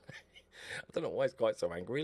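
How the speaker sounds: a mock-conspiratorial whisper to the viewers; playful whisper. Real, not acted. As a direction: breathe it as a secret. Whispered aside, laughing.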